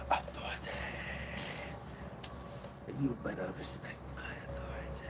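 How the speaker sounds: whispered mock command. Real, not acted. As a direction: hiss a joking order under the breath. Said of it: "unvoiced whisper".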